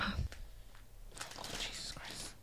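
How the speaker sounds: whispered. Real, not acted. Reacting to spicy chip.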